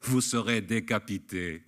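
lightly voiced bright cheerful announcement; ringing, cheerful. A narrator's reading. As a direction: declare it like happy news. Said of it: "d'une voix sonore et joyeuse". (voix sonore et joyeuse, légèrement jouée)

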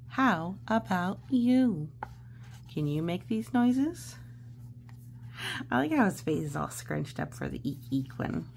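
ordinary delivery, nothing marked

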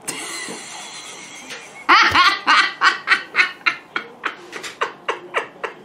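high breathy fit of laughter; helpless giggling at herself; laughing. Real, not acted. A vlogger laughing at her own shawl mix-up.

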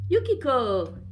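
loud, high call. A narrator's reading. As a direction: call out for her on arriving home. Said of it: "he called".

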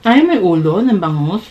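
talking while slurping noodles; chewing between words; mouth full of noodles. Real, not acted. Seen 0: Man has noodles in his mouth; unclear which person speaks.

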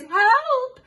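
high loud mock cry for help; hammed-up high yell of Help. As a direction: call out in alarm for the child. Runaway pram in story.

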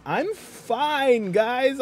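high-pitched, put-on protest; hammed-up high reassurance; mock reassurance. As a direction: insist in a squeaky put-on voice. Protests after mock charity appeal.

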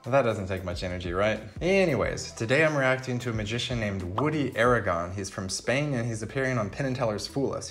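ordinary talk, nothing marked

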